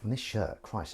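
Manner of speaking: usual speaking voice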